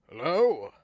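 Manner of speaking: a loud, wary call into the dark. Acted. Stranger calling into the dark, loud and wary. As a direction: call out unsure who's there.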